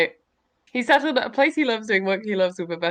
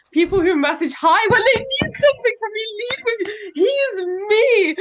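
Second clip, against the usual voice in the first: higher, laughing so hard the words crack; laughing hysterically; 10 semitones higher, 4 dB louder than usual. Real, not acted. Recognising herself in a contestant bio, helpless laughter. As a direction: squeal through tears of laughter. Bio matches her exactly.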